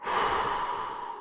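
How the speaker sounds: slow audible breath out, no voice; long deep exhale. Shown as a demonstration. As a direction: let the air go in one long sigh. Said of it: "I did deep breathing".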